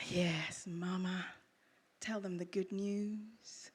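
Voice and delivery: usual speaking voice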